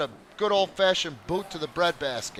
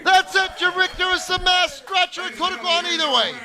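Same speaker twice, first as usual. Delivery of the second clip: excited finish call; 11 semitones higher than usual. Real, not acted. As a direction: announce the winner with excitement. Match ending call.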